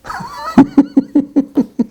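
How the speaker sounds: real high-pitched tittering laugh; high giggle. Real, not acted. Vlogger laughs after punchline, high giggle. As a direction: giggle at your own joke.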